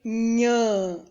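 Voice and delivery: "nhã" drawn out slowly as one nasal syllable; leitura da sílaba. Shown as a demonstration. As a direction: read out "nhã" long and clear. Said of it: "Eu vou ler". ("nhã" lido devagar, alongado)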